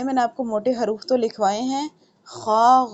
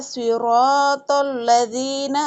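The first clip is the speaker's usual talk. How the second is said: Quran recitation; 4 semitones higher, 4 dB louder, much slower than usual. Shown as a demonstration.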